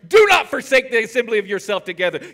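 loud, high pulpit declaration; forceful sermon list; fiery preaching. Acted. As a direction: preach it hard to the congregation.

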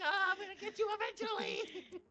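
high-pitched mock villain threat; playful menacing taunt. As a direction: taunt them as you give chase, voice way up high. Killer chasing prey in game.

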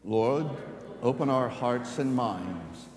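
slow, earnest prayer voice. Acted. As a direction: pray it out slowly. Leads a prayer.

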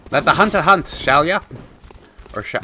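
a hint of a Scottish brogue taunting the beasts; Scottish accent. To the beasts in game, mock Scottish taunt. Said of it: "Why am I trying to be Scottish?".

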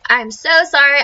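ordinary delivery

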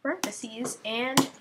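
usual speaking voice

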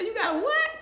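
high, incredulous squeak of a question; can't believe what she just heard; shocked disbelief. Retold or imitated. Stunned woman to police officer, incredulous high question.

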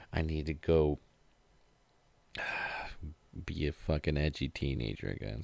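normal talking voice

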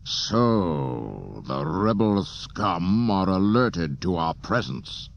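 slow, cold menace as Vader. A narrator's reading. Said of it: "Vader rose to his full".

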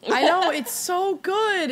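high, loud, slow gushing; excited. Real, not acted. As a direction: gush over the wine. Raving about the wine.

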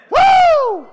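very high joyful woo; ecstatic whoop. Real, not acted. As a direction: whoop like the Spirit hit.

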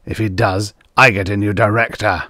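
plain reading voice

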